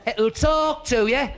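slow, high and loud, every word stretched; shouting for deaf gran. Acted. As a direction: make the hard-of-hearing listener catch it. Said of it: "my gran was a bit Mutt and Jeff".

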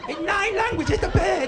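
very high, insistent holler; shouting for deaf gran. Acted. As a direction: drive it into failing ears. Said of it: "my gran was a bit Mutt and Jeff".

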